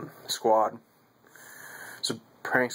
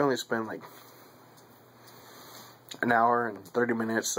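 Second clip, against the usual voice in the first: talking around pipe stem; pipe in mouth. Real, not acted. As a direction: lips clenched on pipe. Seen 2: Pipe stem clenched in mouth while speaking; briefly removed near 4.4.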